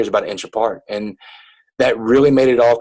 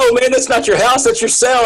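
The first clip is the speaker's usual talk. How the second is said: imitating inmates correcting a friend, pitched up high; higher-voiced, lightly hammed-up objection; mock protest; 10 semitones higher than usual. Repeats inmates' objection to him.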